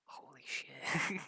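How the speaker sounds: slow, breathless whisper of shock; a stunned hushed holy shit; whispered disbelief. Retold or imitated. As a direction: gasp it out under your breath, slowly. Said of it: "I'll be like".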